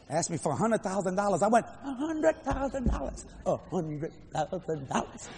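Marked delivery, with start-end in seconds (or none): 1.6-5.3 slow, drawn-out disbelief, high then dropping; stunned disbelief. Retold or imitated. Preacher asked for a hundred thousand dollars, stunned disbelief. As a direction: repeat it slowly as if dumbstruck.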